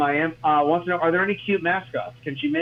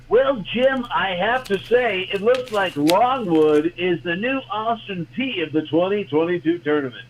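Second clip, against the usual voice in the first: higher-pitched, drawn-out sportscaster send-up; mock TV reporter; 3 semitones higher than usual. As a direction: play a field reporter handing back to the studio. Mock on-air reporter handing back.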